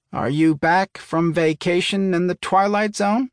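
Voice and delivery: teasing, pitched high; mock concern. A narrator's reading. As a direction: fake worried tease. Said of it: "she said with mock concern".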